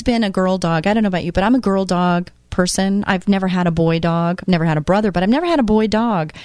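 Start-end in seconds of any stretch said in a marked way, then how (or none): none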